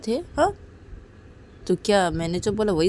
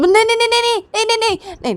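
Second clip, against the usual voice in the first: high, loud frantic no; big sister grabbing her sister's hand, high panicked pleading; 12 semitones higher, 5 dB louder than usual. Acted. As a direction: stop her desperately. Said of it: "हाथ पकड़ लेती".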